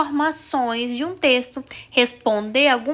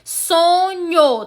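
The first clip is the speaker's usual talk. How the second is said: "Sonhou" in two drawn-out syllables, raised pitch; sílaba por sílaba; 4 semitones higher, 3 dB louder than usual. Shown as a demonstration. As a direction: stretch each syllable of "Sonhou". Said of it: "tem duas sílabas". ("Sonhou" separado em duas sílabas)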